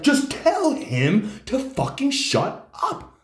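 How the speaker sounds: high, slow, loud snarled order; furious demand. Retold or imitated. Driver snapping at girlfriend to silence brother, furious. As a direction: spit out each word, fed up.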